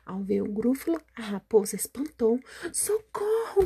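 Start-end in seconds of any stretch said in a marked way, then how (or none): 2.7-3.6 high shriek for help; scared cry. Acted. Fox shrieking for help on seeing the gruffalo, high and scared. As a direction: yelp in panic.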